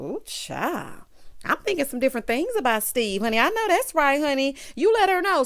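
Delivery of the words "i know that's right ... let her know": excited cheering. Real, not acted. Cheering Steve on.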